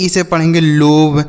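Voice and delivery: ordinary delivery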